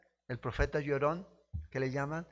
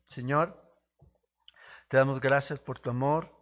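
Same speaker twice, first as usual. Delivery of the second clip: earnest pastor's prayer to God. Acted. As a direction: pray aloud to the Lord for the congregation. Leads a prayer. (oración pastoral sincera)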